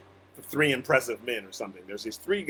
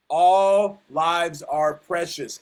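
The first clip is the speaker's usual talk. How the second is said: every word slow, louder, stressing All; slow emphatic stress; 4 semitones higher, 6 dB louder, much slower than usual. Real, not acted. Preacher to congregation, slow emphatic stress on All. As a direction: lay down the core message slowly and firmly.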